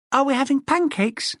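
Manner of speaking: put-on young son listing breakfast, childlike; boy's voice. A narrator's reading. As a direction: sound like a small boy. Boy voiced in story.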